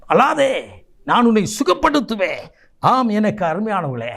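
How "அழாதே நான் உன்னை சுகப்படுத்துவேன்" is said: high, emphatic divine promise; ringing proclamation. Acted. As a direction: proclaim God's comfort with force. God's words repeated as proclamation.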